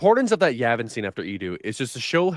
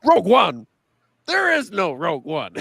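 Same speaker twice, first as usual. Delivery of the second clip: radio exchange quote; 3 semitones higher, 4 dB louder than usual. Retold or imitated. Acting out film's radio exchange.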